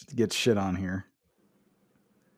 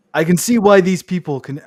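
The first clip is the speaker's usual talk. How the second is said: attempted Austrian accent; 7 semitones higher, 10 dB louder than usual. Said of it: "I need to work on my Austrian accent".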